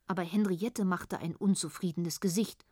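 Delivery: ordinary delivery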